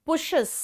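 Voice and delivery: पुशेस said with a clear "shez" ending; correct plural demo. Shown as a demonstration. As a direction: show पुशेस as the easy way. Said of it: "तो फिर कैसे बोलूं". (पुशेस, ईएस साफ़ बोलते हुए)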